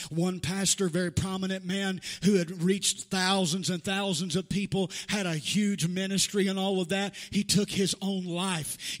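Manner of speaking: loud, driving sermon voice; full-force preaching. Acted. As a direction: preach it to the back row.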